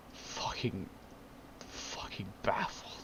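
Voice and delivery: breathless stunned whisper. Real, not acted. Vlogger stunned by the car model, whispered disbelief. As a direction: barely voice it, stunned.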